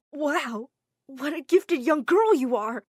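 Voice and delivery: loud, delighted man's voice; booming impressed boss; amazed praise. Acted. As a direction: burst out in amazement. Impressed man praises her.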